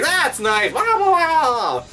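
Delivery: imitating the kid brushing it off, high; mock indifference. Son shrugs off daddy's videos, mock uninterested. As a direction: pitch it up and drag out the blahs. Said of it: "he doesn't even give a damn".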